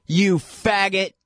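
high, drawn-out mock scream; imitated scream. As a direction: imitate a jeering scream, slow and high without full volume. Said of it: "they're screaming at him".

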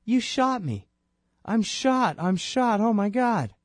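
high, pleading cry of a shot man; shocked disbelief. Retold or imitated. Shot man telling the cops, high and shocked. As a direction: voice the victim gasping in shock. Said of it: "He can't believe it".

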